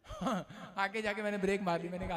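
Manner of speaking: ordinary talk, nothing marked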